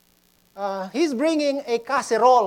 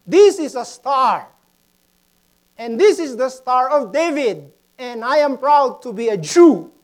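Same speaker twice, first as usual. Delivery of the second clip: loud, raised show-and-tell declaration; acted schoolchild announcement, projected and emphatic; proud student presenting; 4 semitones higher, 8 dB louder than usual. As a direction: declare it proudly to the whole class. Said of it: "the student went in front".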